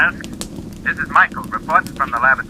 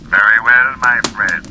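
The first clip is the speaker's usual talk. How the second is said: ordinary talk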